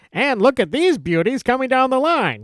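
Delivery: put-on high-pitched old-timey newsreel voice; excited Mid-Atlantic newsreel patter; newsreel announcer. Shown as a demonstration. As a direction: sell it like a vintage newsreel host. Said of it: "the newsreels are very famous for those".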